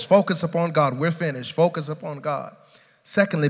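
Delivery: ordinary talk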